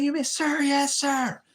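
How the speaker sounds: high, loud recruit shout; shouted sir yes sir. Retold or imitated. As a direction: bark it back at full volume. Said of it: "you're supposed to shout".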